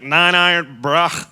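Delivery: a hint of a friend's knowing jab; teasing. Friend calling out his lie, lightly teasing. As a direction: drop it dryly, in on the joke. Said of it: "he said it like that".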